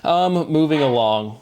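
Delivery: ordinary talk, nothing marked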